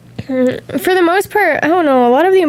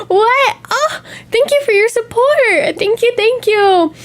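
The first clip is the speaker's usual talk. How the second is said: excited thanks; 8 semitones higher, slower than usual. Real, not acted. Gasping at a viewer's support.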